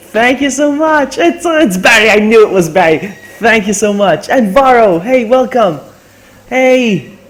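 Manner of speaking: loud, high, overjoyed thanks; thrilled gratitude. Real, not acted. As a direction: shout out thanks and greetings in real excitement. Thrilled thanks to the raiding streamer.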